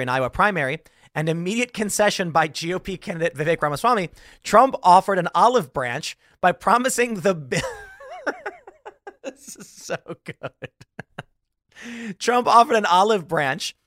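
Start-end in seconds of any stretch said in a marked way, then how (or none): 4.4-7.6 words breaking into real laughter; can't get through the line for giggling; laughing while reading. Real, not acted. Reading the fake article aloud, cracking up mid-sentence. 8.3-12.2 slow, much softer, wheezing through laughs; barely squeezes the words out between laughs; helpless laughter. Real, not acted. Host losing it over the joke article, wheezing laughter.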